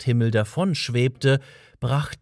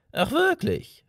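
put-on old man voice. A narrator's reading. Old man's voice, high.

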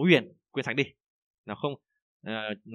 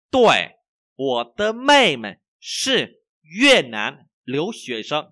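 very slow, loud, word by word; language teacher's model reading; 2 semitones higher, 7 dB louder, much slower than usual. Shown as a demonstration. Teacher reads for learners to repeat, slow model reading.

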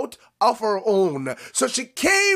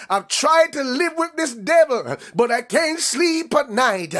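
desperate mother; 4 semitones higher than usual. Acted. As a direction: cry it out like a mother at the end of her rope. Preacher voicing the desperate woman.

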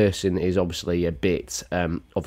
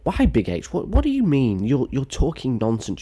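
higher-pitched mock objection; objecting viewer; 6 semitones higher than usual. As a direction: play an indignant heckler, lightly.

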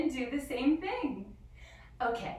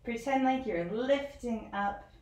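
guided relaxation; 4 dB quieter than usual. Acted. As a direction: ease the children into stillness. Guided rest at the end.

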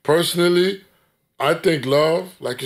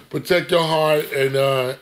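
ordinary delivery

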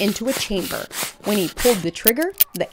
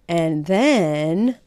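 usual speaking voice

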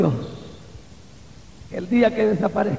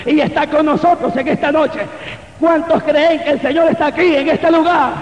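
loud, high preaching; prédica a plena voz; 4 semitones higher, 3 dB louder than usual. Real, not acted. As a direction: proclaim it to the whole church, raised voice. Preaching at full force. (predicando con fuerza, voz alta y aguda)

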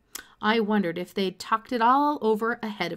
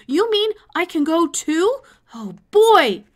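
loud, higher, bursting with joy; excited shouting; 9 semitones higher, 12 dB louder than usual. A narrator's reading. Boy shouting with delight at being invited along. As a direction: shout it out, overjoyed. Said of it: "I shouted".

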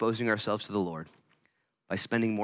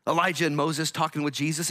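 usual speaking voice

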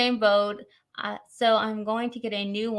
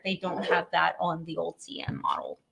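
trailing whisper; 3 semitones lower, 5 dB quieter than usual. Real, not acted. Trailing off while comparing mats.

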